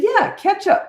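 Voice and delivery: high, emphatic "Yeah, ketchup"; insistent repeat. Retold or imitated. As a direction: spell it out for her. Insisting again louder.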